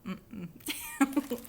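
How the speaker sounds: ordinary delivery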